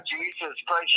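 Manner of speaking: ordinary delivery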